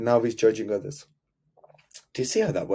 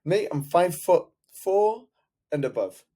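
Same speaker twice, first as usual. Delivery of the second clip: indignant insistence; 7 semitones higher than usual. Retold or imitated. Client insists to coach on a height minimum. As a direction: lay down the height rule.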